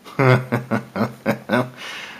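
chuckling in a run of short bursts; delighted laugh. Real, not acted. Vlogger seeing a giant saber-toothed toad, delighted laugh. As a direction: laugh helplessly at the sight.

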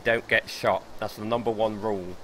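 normal talking voice

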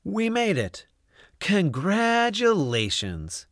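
high, slow relieved congrats; relieved cheer. A narrator's reading. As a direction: savour the win. Relief after return.

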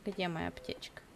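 whispered to herself; whispering. Real, not acted. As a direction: whisper it under the breath. Unvoiced whisper. (шёпотом)

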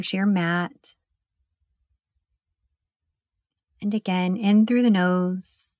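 hushed, even breathing guide with long pauses; guided meditation. Acted. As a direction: lead them gently through each breath, leaving room to breathe.

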